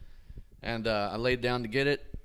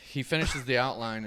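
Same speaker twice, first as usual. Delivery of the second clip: ordinary talk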